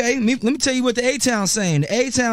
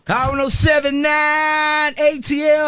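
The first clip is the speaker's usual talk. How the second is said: radio station call; 4 semitones higher, slower than usual. Acted.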